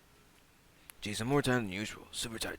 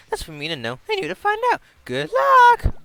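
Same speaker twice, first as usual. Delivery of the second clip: high, loud gloating taunt; Monokuma voice; 14 dB louder than usual. Acted. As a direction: gloat like an evil mascot.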